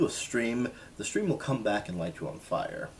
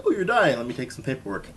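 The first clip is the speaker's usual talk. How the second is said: mocking clerk; 5 semitones higher, 8 dB louder than usual. Mocking indifferent hospital clerk.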